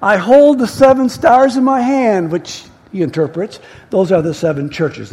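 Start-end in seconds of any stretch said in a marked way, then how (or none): 0.0-2.3 solemn announcement, building higher and louder; name yourself, pause, then proclaim; raised divine declaration; Jesus. Acted. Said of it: "this is Jesus".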